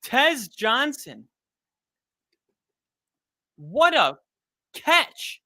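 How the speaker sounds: high, thrilled praise; excited. Real, not acted. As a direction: hype up the catch. Praising the catch.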